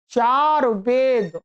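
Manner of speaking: normal talking voice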